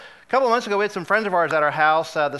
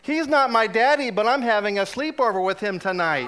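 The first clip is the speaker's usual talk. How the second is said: imitating a little boy's loud, higher-pitched declaration; loud child's announcement; 4 semitones higher than usual. Godfather imitating six-year-old godson telling the checkout person, very loud voice. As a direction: announce it for the whole store, like an unabashed kid. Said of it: "in a very loud voice".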